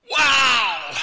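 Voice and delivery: loud, high "Wow!" of astonishment; amazed shout. Retold or imitated. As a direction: shout it out in shock. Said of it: "And I was going".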